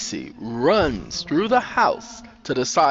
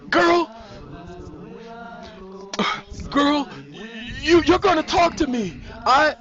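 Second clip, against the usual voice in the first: desperate stammer; 12 semitones higher than usual. A narrator's reading. Sandra outside fearing she is watched, desperate. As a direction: insist frantically, stumbling on words.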